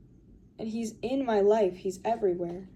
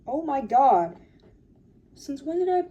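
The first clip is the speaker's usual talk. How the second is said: surprised exclaim; 5 semitones higher, 5 dB louder than usual. Real, not acted. Finds lots of stuff.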